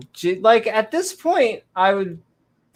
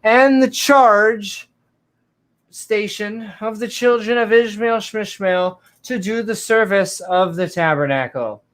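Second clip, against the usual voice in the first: 1 mock solemn scripture; 5 semitones higher than usual.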